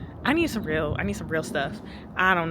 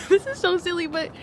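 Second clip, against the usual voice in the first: high, laughing self-mockery; giggling; 12 semitones higher than usual. Real, not acted. Confessing a silly reading habit to viewers, giggling. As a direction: say it through a giggle, pitched up.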